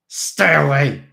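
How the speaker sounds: put-on menacing drunken shout; threatening. A narrator's reading. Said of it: "he hissed".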